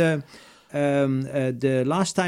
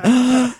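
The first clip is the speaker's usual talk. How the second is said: gasp; 8 semitones higher, 6 dB louder than usual. Retold or imitated. Realising the flight is leaving.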